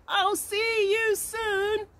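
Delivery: high, slow call from the sky; bee calling out. Acted. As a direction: call goodbye while flying away. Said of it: "called out loud".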